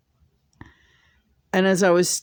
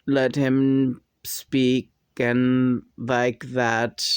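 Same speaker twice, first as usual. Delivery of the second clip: halting speech; 3 semitones lower, much slower than usual. Shown as a demonstration. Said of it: "very stilting".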